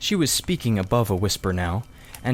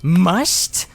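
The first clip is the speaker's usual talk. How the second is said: put-on one louder questioning word from an old man; mock outrage at being told what to do; Uncle Andrew, indignant; 8 semitones higher, 6 dB louder than usual. A narrator's reading. Uncle repeating Diggory's word back at him, louder and indignant.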